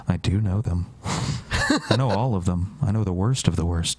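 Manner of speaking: ominous friend. Retold or imitated. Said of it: "And he's like".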